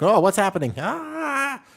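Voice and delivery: loud, high hammed-up panic ending in a yelp; a sudden loud "oh!" and high scream; mock panic. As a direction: play the confused foreigner, startled and yelping.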